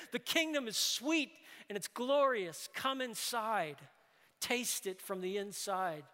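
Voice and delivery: loud, high, urgent preaching; full-force preaching. Acted. Preacher inviting the congregation into the kingdom, fervent and loud. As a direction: call the whole room in with fire.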